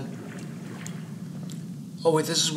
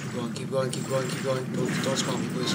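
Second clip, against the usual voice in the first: hushed pleading; 5 dB quieter than usual. Real, not acted. Creeping past a monster.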